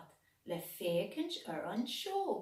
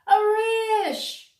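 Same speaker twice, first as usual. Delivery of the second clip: much higher, loud cheering call; crowd calling out; 14 semitones higher, 13 dB louder than usual. A narrator's reading. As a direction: shout for an encore. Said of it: "ar son slua".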